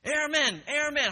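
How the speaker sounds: very high, loud holler; yelling drill instructor. Retold or imitated. Drill instructor singles out a stray recruit, hollering. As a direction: holler it across the barracks. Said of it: "he's yelling".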